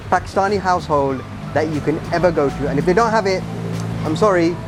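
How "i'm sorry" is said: normal talking voice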